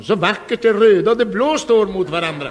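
high, loud, over-eager praise; nervous gushing. Retold or imitated. Flustered suitor praising fabric to the father, over-eager. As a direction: keep gushing in a panic. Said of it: "jag fortsatte bara farten".

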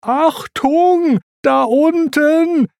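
high, drawn-out warning shout; stretched, high-pitched holler; Pumba's warning cry. A narrator's reading. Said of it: "mit dem Aufschrei".

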